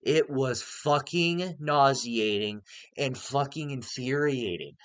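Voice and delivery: normal talking voice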